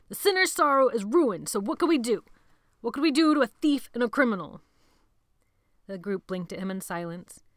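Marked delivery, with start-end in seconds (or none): 0.1-4.6 loud, higher near-shout over the storm. A narrator's reading. Sebastian shouting over the storm at the gathered boys. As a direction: shout above the wind. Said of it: "He had to almost shout".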